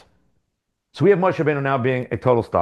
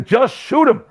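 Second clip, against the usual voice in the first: mock Bond villain, much higher, blurting "Just shoot him"; exasperated villain; 10 semitones higher, 3 dB louder, slower than usual. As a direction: toss it off like an impatient bad guy. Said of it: "And the other guy".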